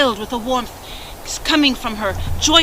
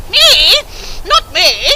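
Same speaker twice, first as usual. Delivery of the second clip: much higher whiny protest; spoiled stepsister; 12 semitones higher, 4 dB louder than usual. Acted. Stepsister refuses her mother, high and whiny. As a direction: whine like a pampered girl.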